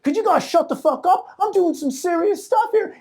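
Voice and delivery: high, loud-ish plea to hecklers; indignant performer. Retold or imitated. Pretty boy scolding the chatting bar crowd, indignant. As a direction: imitate an affronted singer pleading up high. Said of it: "he had a British accent".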